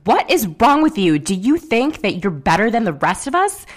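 mock angry snap. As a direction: throw Brandi's furious question back, hammed up. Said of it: "Brandi snaps".

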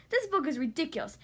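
usual speaking voice

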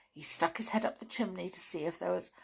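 ordinary delivery

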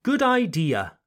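Good idea said eye-DEE-uh, clear final A; model for repeating. Shown as a demonstration. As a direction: say Good idea for learners to copy. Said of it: "Repeat after me".

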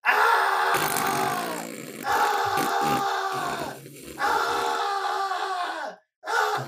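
wild yells breaking into cries; screaming. Pretend. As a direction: scream raw from across the room. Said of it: "I'll be Ronnie".

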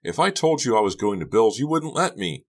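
high-pitched heated argument; exasperated teen protest; snapping back. A narrator's reading. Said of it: "Becca snapped".

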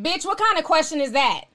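ordinary talk

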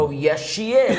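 slow, laughing reply as dad; playfully imitating dad's teasing; dad chuckling as he teases his daughter. Dad teasing daughter about Lana.

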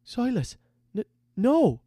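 put-on high, stammering boy's plea; protesting young recruit. A narrator's reading. Recruit begs his leader to stop, high protest. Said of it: "Hap protested".

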